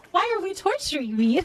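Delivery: high, whiny mock complaint; mock anguish. Real, not acted. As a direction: playfully whine about the torture. Mock complaint about watching Titanic.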